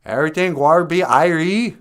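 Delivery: loud, high mock Jamaican accent; hammed-up Jamaican accent; thick Jamaican accent. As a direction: belt it in broad Jamaican patois. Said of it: "he's got a thick, thick Jamaican accent".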